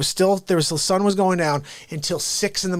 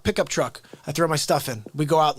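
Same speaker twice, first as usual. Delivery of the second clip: ordinary delivery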